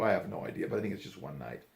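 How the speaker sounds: usual speaking voice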